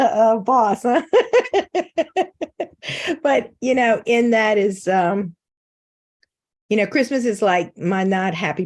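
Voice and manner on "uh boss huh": high, giggling tease; laughing. Real, not acted. Teasing the host about sleeping with the boss, laughing.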